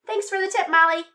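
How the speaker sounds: high-pitched, playful thanks; thanking Molly. Real, not acted. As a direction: thank them high, as if to a pet. Thanking special guest Molly.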